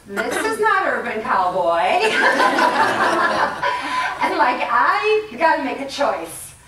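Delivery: slow, loud words through laughter; laughing. Real, not acted. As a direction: laugh through the memory of the fall. Recounting fall, laughing.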